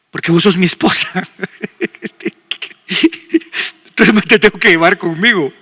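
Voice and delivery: husband's joking retort, breaking into laughter; amused, acted protest with laughter; laughing comeback. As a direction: argue back through a wheezy laugh.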